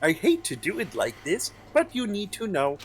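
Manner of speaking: melodic narrator voice. Acted. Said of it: "my melodic, angelic, harmonic narrator voice".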